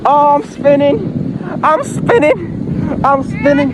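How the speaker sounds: high, slow excited cry; sledding downhill. Real, not acted. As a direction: ride it out, spinning and yelping. Spinning on the sled.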